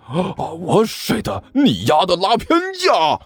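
angry yell. A narrator's reading. Zhang Yefei accuses Liu Abei of taking sides, angry yell. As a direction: cry foul in a high, indignant voice. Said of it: "大吼了一声".

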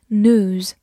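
"news" in American English as "nooz", no y-glide; American pronunciation. Shown as a demonstration. Teacher contrasts with the American pronunciation of "news". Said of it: "或者美式发音".

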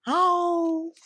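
a high, loud roar from offstage; tiger roar. A narrator's reading. The tiger roars, startling the hiding animals. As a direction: let a tiger's roar ring out. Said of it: "忽然听见嗷".

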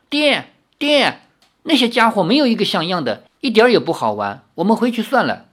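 high, quick whiny daughter's voice; spoiled girl. Acted. Daughter whining to her father, high and quick. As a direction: pout to daddy that it's boring.

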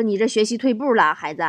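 ordinary talk

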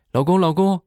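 high calling through the door; mock wife calling out high. Wife knocking on the door calling her husband, high.

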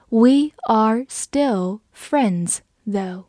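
very slow, each word drawn out; slow read. Shown as a demonstration. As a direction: say it slowly to show the phrasing. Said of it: "你还是可以说这一句". (放慢语速逐词说)

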